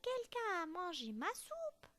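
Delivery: put-on small child bear, plaintive; toute petite voix. A narrator's reading. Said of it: "de sa toute petite voix". (toute petite voix d'ourson)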